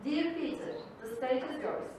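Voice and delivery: usual speaking voice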